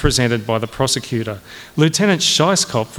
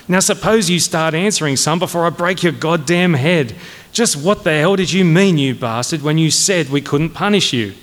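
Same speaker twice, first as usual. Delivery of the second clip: higher-pitched bullying interrogation; angry demand; 4 semitones higher than usual. Acted. Colonel threatening a cadet to make him answer, angrily. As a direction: demand an answer with mounting impatience.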